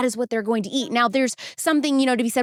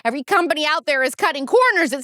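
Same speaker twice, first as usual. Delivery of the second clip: higher, punchy sponsor-read opener; 6 semitones higher than usual. Acted. Host opening a sponsor spot for listeners, punchy ad read. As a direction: open an ad with exaggerated, theatrical complaint.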